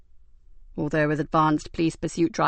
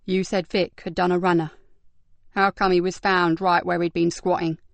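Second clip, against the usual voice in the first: usual speaking voice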